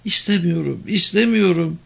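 high-pitched tearful refusal. A narrator's reading. Woman can no longer hold back crying, tearful. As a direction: refuse as if giving way to tears. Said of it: "Summa artık ağlamasına karşı koyamıyordu".

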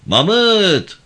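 high, loud call at the door; summoning a man from outside his house. A narrator's reading. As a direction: shout a name to someone inside. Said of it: "kapının önünde durup seslendi".

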